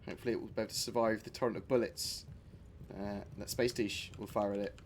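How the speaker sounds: usual speaking voice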